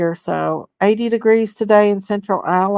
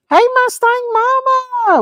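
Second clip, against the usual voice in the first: much higher sing-song call; excited greeting; 13 semitones higher than usual. Real, not acted. Greeting a viewer in chat, high excited call. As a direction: call the name out brightly.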